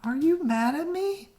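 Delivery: high, anxious pleading voice; worried little Opal. Acted. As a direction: ask it like a nervous child. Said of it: "She called out to her friend".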